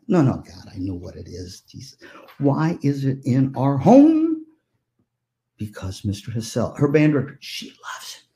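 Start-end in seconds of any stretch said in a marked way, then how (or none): none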